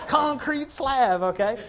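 drawn out high and loud through laughter; laughing. Real, not acted. Preacher jokes with the pastor about the floor, laughing. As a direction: laugh through the words, stretching them.

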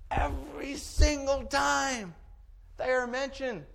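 builds to a high, slow shout on "every single time"; preaching shout. Real, not acted. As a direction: hammer it home, rising and slowing.

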